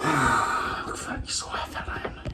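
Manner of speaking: soft whisper under the breath; whispered aside. Real, not acted. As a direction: whisper it to yourself. Muttered self-deprecating aside.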